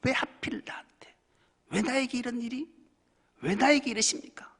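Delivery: high, plaintive why-me complaint; whining why. Retold or imitated. Sufferer asking God why me, high and plaintive. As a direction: play the self-pitying sufferer. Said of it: "왜라는 단어를 빼야 돼요".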